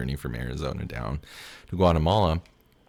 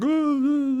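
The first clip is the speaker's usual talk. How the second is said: high, loud mock wail; reliving the breakdown, imitated sob; 7 dB louder than usual. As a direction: let out a hammed-up crying wail. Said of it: "just sobbing and crying, like".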